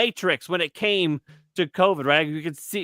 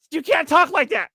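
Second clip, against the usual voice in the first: much higher scolding voice; imitated scolding wife; 13 semitones higher, 4 dB louder than usual. Wife scolding her husband on the phone, high imitated scold.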